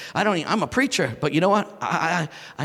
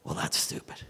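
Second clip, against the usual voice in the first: a dismissive whisper, much softer; whispered scoff; 8 dB quieter than usual. Retold or imitated. Preacher scoffing at God's sock instruction, whispered. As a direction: scoff under your breath. Dismissing the Lord's odd request.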